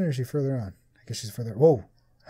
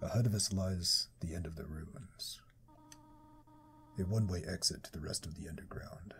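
demonic whisper; 6 semitones lower, 10 dB quieter than usual. Acted. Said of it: "I'm giving her a demonic voice".

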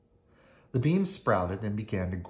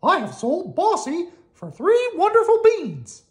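high, proud little Mickey voice; Mickey's voice; 10 dB louder than usual. Acted. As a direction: boast about the beans in a high cartoon mouse voice. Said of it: "he said".

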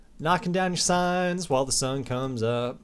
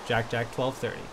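normal talking voice

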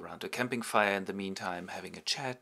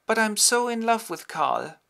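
lovesick Amalia; 11 semitones higher, 7 dB louder than usual. Acted. Amalia pining for Karl.

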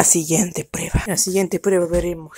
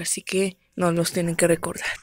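ordinary talk

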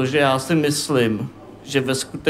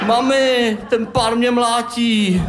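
high whiny telling-on voice; boy complaining to his mother, high and whiny; tattling crying kid; 6 semitones higher, slower than usual. Acted. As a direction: whine to mum like a wronged child. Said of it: "u brečínek začal žalovat".

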